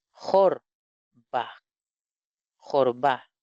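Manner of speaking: "jorba" with rasped j and O; "jor... ba", measured and separated; shown reading. Shown as a demonstration. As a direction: say "jorba" syllable by syllable. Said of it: "Ahí dice".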